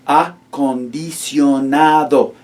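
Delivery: Acondicionado drawn out slowly, one syllable at a time. Shown as a demonstration. Teacher slowly modelling Acondicionado for students, syllable by syllable. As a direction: break Acondicionado into slow syllables. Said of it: "We break them down into syllables".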